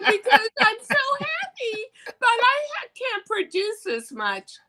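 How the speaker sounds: high, teary mock sob of joy; happy crying. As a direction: squeak it out through happy tears. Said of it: "I burst out in tears".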